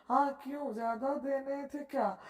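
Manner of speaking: usual speaking voice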